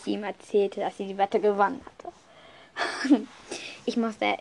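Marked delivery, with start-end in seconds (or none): 2.8-3.2 ein kurzes, leises Auflachen; kurzes Lachen. Real, not acted. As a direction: ein kleines Lachen entwischt. Maximus verliert die Wette. (kurzes leises Lachen)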